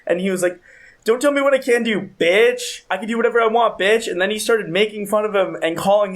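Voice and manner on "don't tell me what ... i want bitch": sneering at the man who stopped him; mock angry yell. Drunk brother yelling at passerby.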